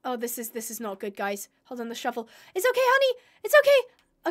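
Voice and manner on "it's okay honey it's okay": high, cooing reassurance; cooing to a hurt dog; soothing pet voice. Real, not acted. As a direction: comfort a hurt pet gently.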